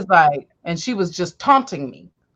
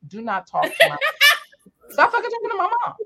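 laughing scold; 13 semitones higher than usual. Retold or imitated. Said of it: "had to tell one of my girlfriends".

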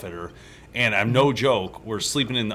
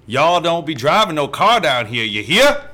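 loud, higher-pitched hammed-up Southern drawl; attempted Southern accent; 5 semitones higher, 8 dB louder than usual. Shown as a demonstration. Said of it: "I'm gonna try it".

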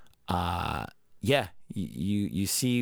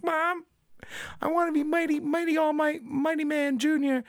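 put-on much higher, excited little-boy voice; little kid Deku. Little Deku telling his mom his hero dream, eager kid voice. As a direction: beg mom like an eager small child. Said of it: "and he's like".

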